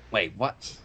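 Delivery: ordinary delivery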